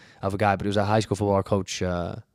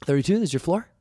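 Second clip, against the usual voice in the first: a touch higher, shaky panicked announcement; nervous attendant; 9 semitones higher than usual. Retold or imitated. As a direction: announce the floor while still panicking. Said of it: "this really nervous".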